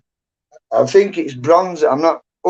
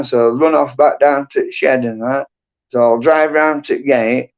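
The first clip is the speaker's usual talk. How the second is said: usual speaking voice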